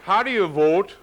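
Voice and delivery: high, loud, eager voice of a voter asking his wife; a voter bursting to ask how to vote; excited Minnesota voter. Retold or imitated. Voter asking his wife where to vote, loud and eager.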